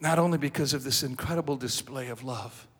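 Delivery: normal talking voice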